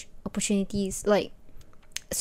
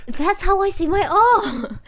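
excited discovery; 9 semitones higher, 5 dB louder, slower than usual. Retold or imitated. Child recognising her own R on TV.

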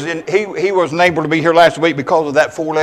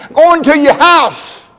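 slow, loud, higher repeat; emphatic proclamation; 9 semitones higher, 9 dB louder, slower than usual. Retold or imitated. Preacher repeating Jesus's words to the church, slow and loud. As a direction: lean into every word. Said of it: "Thank you, Gloria".

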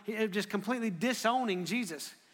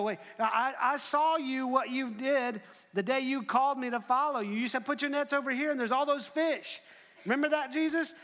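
higher-pitched, insistent appeal; acted protest, pitched up; pleading reminder; 6 semitones higher than usual. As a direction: plead your case urgently.

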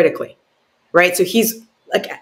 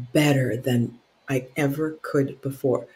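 hushed recollection; 5 semitones lower, 10 dB quieter than usual. Retold or imitated. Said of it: "I remember that moment of".

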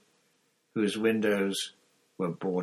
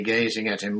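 plain reading voice

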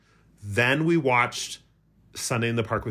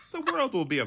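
laughing. Real, not acted. Laughing at own dark joke.

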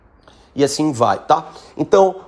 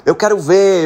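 louder tiger challenge up at the tree; tiger shouts; 4 semitones higher, 5 dB louder than usual. Acted. Tiger yelling up at the cat in the tree, mild shout. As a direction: call up to the cat, daring it. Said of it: "o tigre grita lá".

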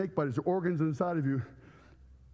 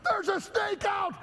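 high, slow yelled alarm; panicked yell; slower than usual. Retold or imitated. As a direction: scream the warning to the room. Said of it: "screams out the top of her lungs".

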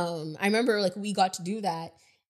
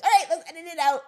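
higher, louder, quick and bright-voiced fix-it; quick excited reply; 9 semitones higher, 7 dB louder, faster than usual. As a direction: blurt it out like fixing a slip fast. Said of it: "And then I'm like".

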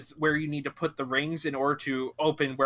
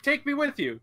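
high-pitched plea; 9 semitones higher than usual. Retold or imitated. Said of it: "she's like".

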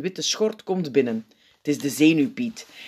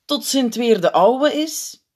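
slow, higher, tentative; aarzelend; 9 semitones higher, 4 dB louder, slower than usual. A narrator's reading. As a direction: trail the suggestion out cautiously. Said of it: "zegt een piet aarzelend". (aarzelend, langgerekt)

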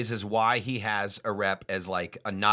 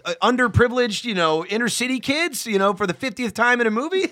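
exasperated outburst; 10 semitones higher, 4 dB louder than usual. Retold or imitated. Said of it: "and I'm like".

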